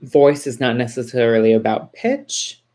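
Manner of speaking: even phrase that swings up on the last word, "pitch"; upward swing. Shown as a demonstration. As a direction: demonstrate intonation with a lift up on "pitch". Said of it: "So having those highs".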